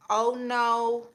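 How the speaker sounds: ordinary talk, nothing marked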